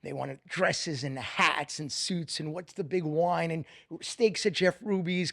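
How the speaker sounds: usual speaking voice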